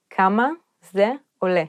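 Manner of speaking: כמה, זה, עולה with a pause between each; word by word. Shown as a demonstration. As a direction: break כמה זה עולה into separate words for a learner. Phrase then broken down word by word.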